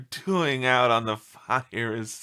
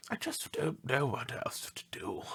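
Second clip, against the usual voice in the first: hushed, breathy whisper of despair; 11 dB quieter than usual. Acted. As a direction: whisper it as if at wit's end. Distraught owner quoted in news.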